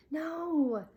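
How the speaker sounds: ordinary delivery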